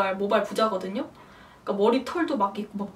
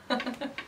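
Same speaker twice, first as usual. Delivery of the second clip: soft, breathy laugh; amused chuckle; 9 dB quieter than usual. Real, not acted. Mother recalling her rare delivery antics, amused chuckle. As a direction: chuckle quietly at your own story.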